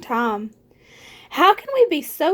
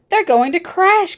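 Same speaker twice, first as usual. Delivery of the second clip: higher, louder alarmed cry; alarmed gasp; 6 semitones higher, 5 dB louder than usual. A narrator's reading. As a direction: gasp out the danger. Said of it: "gasped Stellaluna".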